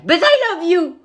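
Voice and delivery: high, excited declaration of love; gushing fan. Pretend. Him to TikTokers he admires, high and gushing. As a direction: burst out high after a shy aside.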